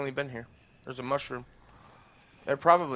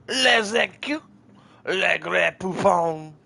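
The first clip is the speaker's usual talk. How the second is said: loud, higher fake French accent, slow; french chef; 5 semitones higher, 7 dB louder, slower than usual. Mock French gibberish.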